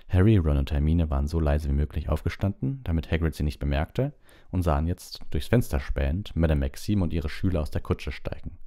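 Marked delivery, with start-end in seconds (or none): none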